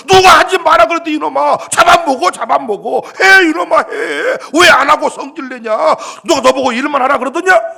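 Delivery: loud, scolding father's shout; father scolding son. Acted. Father to elder son, angry loud scolding. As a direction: bellow at the sulking elder son.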